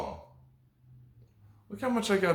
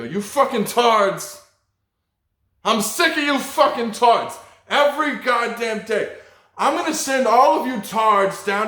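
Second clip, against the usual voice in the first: loud, raised-pitch mock teacher tirade; angry teacher shouting; 6 semitones higher, 6 dB louder than usual. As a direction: berate the class as a fed-up teacher, hammed-up. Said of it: "what my teacher used to call us all".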